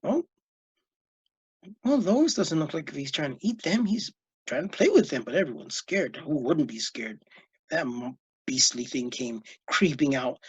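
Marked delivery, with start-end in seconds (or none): none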